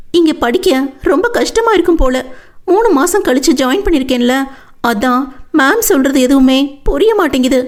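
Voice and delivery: Vennila, upset student. Acted.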